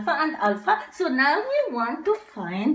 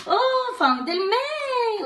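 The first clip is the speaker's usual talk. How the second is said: excited discovery; 9 semitones higher than usual. Pretend. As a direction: celebrate finding it. Teacher excited finding letter.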